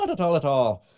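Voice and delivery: put-on high, loud stage Irish accent; singsong Irish brogue.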